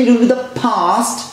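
lightly put-on British past; a hint of British English in past; imitating British accent. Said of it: "তিনি বলার try করছেন".

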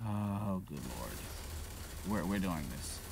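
ordinary delivery

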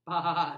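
loud bleating bah; sheep bleat. Acted. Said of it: "said Sheep".

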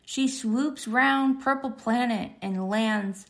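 plain reading voice

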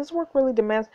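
ordinary talk

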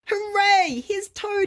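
high, excited squeal of welcome; joyful cry. A narrator's reading. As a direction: burst out cheering at the sight of a friend. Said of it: "he cried".

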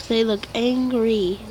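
ordinary delivery

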